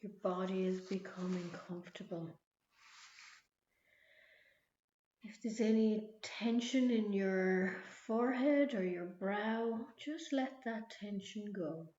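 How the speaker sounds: guided meditation. Acted. Leads a relaxation visualization.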